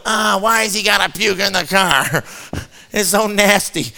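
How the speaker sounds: mock whine of a disgusted brother; whining kid. Brother in the back seat beside his puking sibling, whining. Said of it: "Killian means whining".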